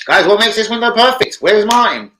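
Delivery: loud and high, calling out to chat; excited high shout to chat. Real, not acted. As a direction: call out loud with rising excitement.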